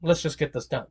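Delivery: low, quiet assurance; a hint of a smooth rich man; lightly voiced Muzan. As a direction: Muzan calmly agreeing to pay. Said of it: "he could just be like".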